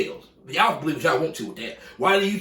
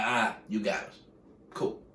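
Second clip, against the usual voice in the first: grudging concession; 8 semitones lower, 7 dB quieter than usual. Retold or imitated. Packers voiced conceding to the league.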